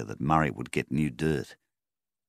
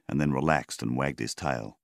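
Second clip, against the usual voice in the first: plain reading voice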